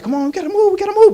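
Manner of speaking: a dog begging its owner to hurry; frantic dog. Acted. Dog pleading with its owner to flee, frantic. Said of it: "That dog might get frantic, like".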